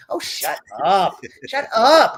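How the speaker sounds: playful loud yell of shut up; mock shout. Joking banter, repeated louder.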